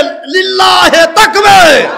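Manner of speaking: high, stretched full-force cry. Real, not acted. Preacher demands a stronger slogan, roaring. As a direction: push the chant as loud as possible. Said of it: "আরো জোরে".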